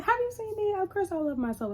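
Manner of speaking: high-pitched, self-assured retort; mock indignant. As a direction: imitate someone affronted and full of herself. Acts Toni's self-loving retort.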